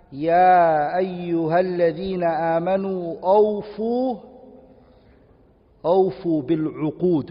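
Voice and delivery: slow, drawn-out chanted recitation of the verse; Quran recitation. Acted. Teacher reciting the verse to students in slow chant. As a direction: recite the ayah slowly and reverently.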